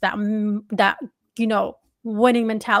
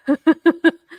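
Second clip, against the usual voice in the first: laugh; 6 semitones higher, 8 dB louder than usual. Real, not acted. Laughs at trade talk.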